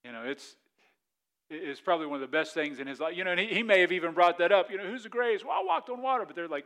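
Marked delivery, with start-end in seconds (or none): 4.8-6.2 boastful Peter. Acted. Acts out Peter boasting to disciples.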